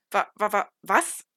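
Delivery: loud, stumbling stammer on the first syllable; stammering in shock. A narrator's reading. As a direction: trip over the word, caught completely off guard. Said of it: "haspelte ich". (stotternd und laut vor Schreck)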